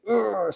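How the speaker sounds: mock groan of taking it too seriously; heavy groan. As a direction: groan under imagined heaviness. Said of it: "serious all the time and everything was heavy and hard".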